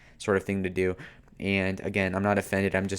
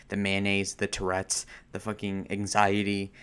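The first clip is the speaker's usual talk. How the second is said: normal talking voice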